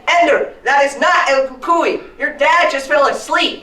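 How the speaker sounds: high, loud emphatic scolding; exasperated mother. Acted. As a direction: shout it word by word, fed up. Acts exasperated mother scolding child.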